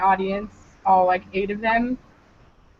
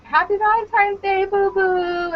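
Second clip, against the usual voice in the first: high, drawn-out sweetheart coo; imitated lovey-dovey greeting; 7 semitones higher, slower than usual. Caller wishing boo boo happy Valentine's, high and cutesy. Planned gushing greeting to lover on phone.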